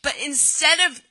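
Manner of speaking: usual speaking voice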